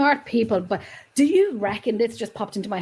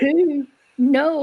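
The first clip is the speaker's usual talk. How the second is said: higher, louder mock German accent; badly faked German, pitched up; 5 semitones higher, 5 dB louder than usual. Asking who knows what, in bad German accent. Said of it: "That was my really bad German accent".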